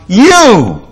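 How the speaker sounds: high, loud exultant cry; a psalmist crying out high and loud to the Lord. Acted. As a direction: call it out in joy to God. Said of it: "He cries out".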